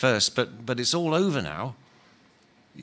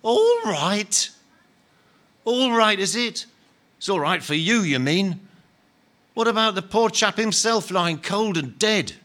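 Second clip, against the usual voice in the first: higher, louder incredulous fury; indignant outrage; 8 semitones higher, 4 dB louder than usual. Acted. Big ghost outraged at Len's calm, shouting back. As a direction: hurl his words back in disbelief.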